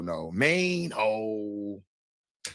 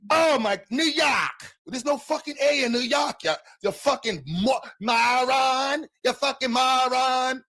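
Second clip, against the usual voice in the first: put-on New York accent; 10 semitones higher, slower than usual. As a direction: fire back like an offended New Yorker. Angry New Yorker mocking Boston.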